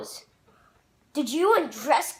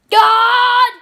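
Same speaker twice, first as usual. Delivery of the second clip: loud high cry of "God"; loud despairing yell; desperate shout; 8 semitones higher, 12 dB louder than usual. Acted. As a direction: call out to the heavens.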